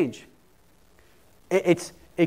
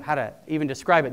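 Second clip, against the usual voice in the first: ordinary talk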